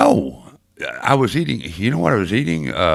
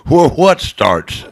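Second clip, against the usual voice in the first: mock indignant; 8 semitones higher, 8 dB louder, slower than usual. Reacting to a teasing listener text.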